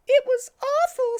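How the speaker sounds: put-on squeaky high girl voice; high Amy voice. Amy tells Sonic of her ordeal, high squeaky voice. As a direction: pitch way up as Amy, upset.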